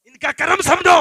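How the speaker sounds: loud, high preaching shout; shouted exhortation. Real, not acted. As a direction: hammer it home at full volume. Repeats the words at full force.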